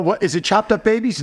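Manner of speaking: high, incredulous mock question; mock customer. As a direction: ham up a silly customer. Mimicking customer's silly question.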